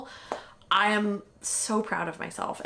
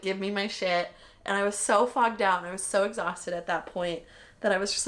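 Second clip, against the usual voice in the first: ordinary delivery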